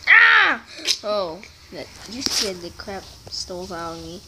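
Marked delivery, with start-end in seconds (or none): 0.1-0.6 sudden high startled scream; scream into giggles. Real, not acted. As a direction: yelp in real fright. Startled by jump in game.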